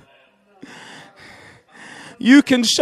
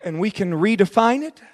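normal talking voice

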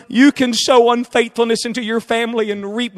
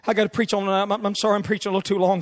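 ordinary talk, nothing marked

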